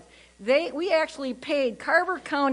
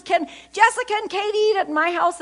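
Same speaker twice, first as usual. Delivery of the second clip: mock daughter yelling; 9 semitones higher than usual. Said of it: "She yells down".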